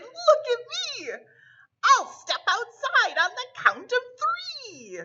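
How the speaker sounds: high, excited showing off; excited shout. A narrator's reading. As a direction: call out proudly while twirling. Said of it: "He twirled and shouted".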